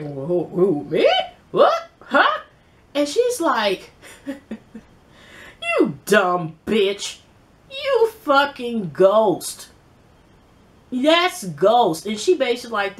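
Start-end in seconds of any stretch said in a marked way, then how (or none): 0.0-2.4 mock caught innocent. Angie caught, plays innocent. 4.1-12.0 slow, laughing scorn; mocking laughter. Holly laughs at Angie while naming Ghost, slow and scornful.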